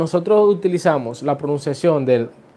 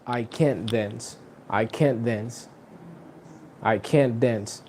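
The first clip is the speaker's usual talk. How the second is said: "can't" stressed, much softer and lower; 4 semitones lower, 8 dB quieter, slower than usual. Shown as a demonstration. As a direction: press "can't", keep the rest quiet. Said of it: "the other one is". (énfasis en "can't", más bajo y suave)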